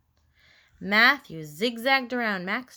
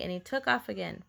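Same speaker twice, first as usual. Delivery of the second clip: ordinary delivery, nothing marked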